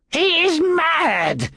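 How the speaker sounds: high, drawn-out cry of outrage; outraged hiss. A narrator's reading. Accused priest protests to the room, high outraged hiss. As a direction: stretch each word out, pitched very high. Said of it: "Pompedius hissed".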